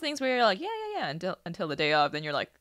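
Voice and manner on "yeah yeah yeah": mock agreeing.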